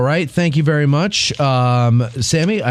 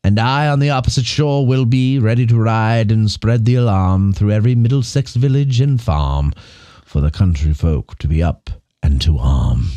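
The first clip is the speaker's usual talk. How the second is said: put-on British accent; 2 semitones lower than usual. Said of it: "Why is he British?".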